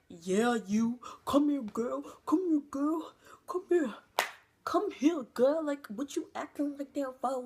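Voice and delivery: high, loud mocking catcall, rising to a whisper; catcalling guys. Guys at the wetlands call her over, high loud catcalls. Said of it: "And they were like".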